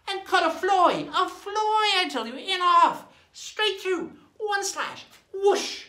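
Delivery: high, loud, thrilled telling; excited storytelling. A narrator's reading. Storyteller relives the amazing cutlass slash, high excitement. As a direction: pitch it way up, punching out each stroke.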